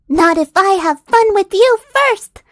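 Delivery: loud, high defiant cry; Candy shouting at the ogre as she attacks; heroic battle shout. Acted. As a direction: yell the comeback as you charge.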